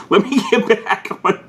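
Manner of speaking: laughing. Real, not acted. Laughs at himself mid-sentence.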